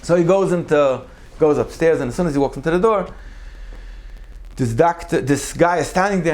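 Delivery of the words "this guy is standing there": ordinary talk, nothing marked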